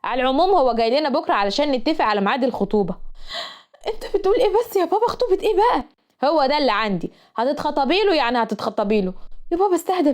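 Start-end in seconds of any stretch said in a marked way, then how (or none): 3.9-5.8 exasperated protest. Acted. Daughter protests engagement to father.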